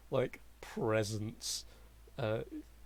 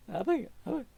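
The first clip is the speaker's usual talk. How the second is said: pleading; 6 semitones higher, 6 dB louder than usual. Acted. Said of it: "clutching onto your leg very tightly and goes".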